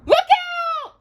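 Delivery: very high loud yell; warning shout. Acted. Aladdin warns Abu of the falling tower, high yell. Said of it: "Aladdin shouted".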